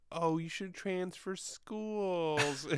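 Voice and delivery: high, slow, sappy mimicry; mock the soppy line, dragging it out; sarcastic lovesick parody; mocking whiny quote. Mocking the love-story dialogue.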